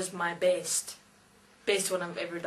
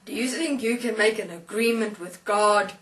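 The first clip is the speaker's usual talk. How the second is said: loud, affronted angelic challenge; imperious angel; 2 semitones higher, 5 dB louder than usual. Retold or imitated. Angel challenging the atheist's bargain with God, imperious. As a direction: demand it like an almighty angel. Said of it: "Then I, as this angel".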